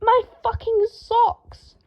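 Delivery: very high, slow outraged cry; anguished cry. A narrator's reading. Draco finding his dirty socks, outraged cry. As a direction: cry out at the ruin.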